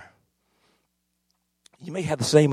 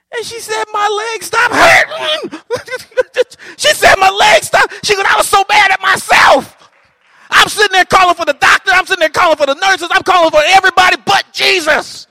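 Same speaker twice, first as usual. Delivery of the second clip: high, loud retold testimony; preaching shout; 9 dB louder than usual. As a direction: cry out her healing, way up high. Preacher retelling healing testimony.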